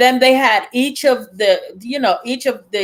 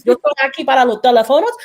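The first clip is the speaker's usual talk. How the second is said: Spanish greeting; faster than usual. Retold or imitated. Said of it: "I gotta throw in a little Spanish".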